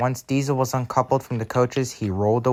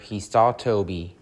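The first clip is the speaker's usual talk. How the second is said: plain reading voice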